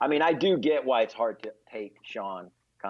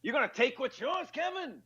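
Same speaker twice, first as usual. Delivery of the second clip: much higher laughing taunt; taunting Pacino; 10 semitones higher than usual. Retold or imitated. Comedian voices Al Pacino goading Keanu, mock taunt. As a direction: goad him like Pacino egging on Keanu. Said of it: "I go".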